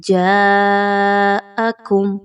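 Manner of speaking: جاءکم with a very long held aa; stretched madd. Shown as a demonstration. Teacher joins the syllables into one word. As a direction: drag out the aa in جاءکم. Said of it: "تو آپ نے یہاں پر پڑھنا ہے".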